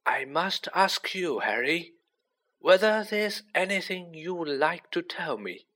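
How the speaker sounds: put-on gentle old mentor's invitation. A narrator's reading. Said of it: "he said gently".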